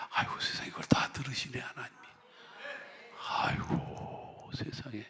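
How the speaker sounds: hushed, breathless wonder; soft amazed whisper; awed whisper. Real, not acted. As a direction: whisper in awe at the miracle. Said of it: "awed hush at God hearing".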